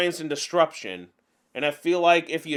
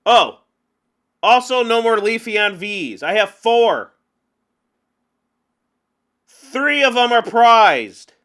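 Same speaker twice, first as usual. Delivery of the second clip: frustrated outburst; 5 semitones higher, 8 dB louder, slower than usual. Real, not acted. Groaning at prized cards.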